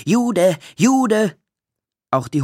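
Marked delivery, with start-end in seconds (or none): 0.1-0.6 "Jude" called out high and loud; taunting yell of "Jude"; kids' jeering call; jeering shout. A narrator's reading. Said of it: "mit dem Rufe". 0.8-1.4 "Jude!" yelled even higher and loud; shrill taunting repeat of "Jude!"; climaxing jeer; louder jeering shout. A narrator's reading. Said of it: "mit dem Rufe".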